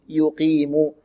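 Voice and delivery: ordinary talk, nothing marked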